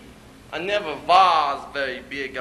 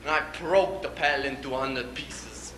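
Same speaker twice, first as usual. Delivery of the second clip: usual speaking voice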